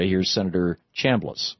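usual speaking voice

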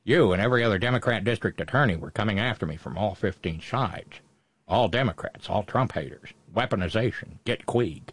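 low, muttered tough-guy movie impression; bad Humphrey Bogart. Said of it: "in my bad Bogart impression".

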